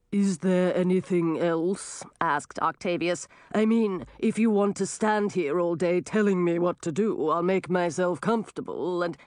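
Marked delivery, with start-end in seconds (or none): 0.1-2.0 slow, drawn-out octopus voice; grumbling sea creature; gurgling tentacle alien. Acted. 3.5-9.2 grumbling octopus voice; put-upon sea creature complaining; gurgling tentacle alien. Acted. Octopus character voice.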